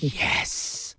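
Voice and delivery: hushed, whispered mock cheer; secret delight. Host teasing the guest about his secret reaction, whispered mock delight. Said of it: "secretly, were you like".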